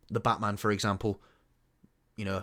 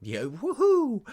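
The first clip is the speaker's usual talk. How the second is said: sarcastic woohoo; 14 semitones higher, 6 dB louder, much slower than usual. Acted. Mock cheer at Rebel Moon.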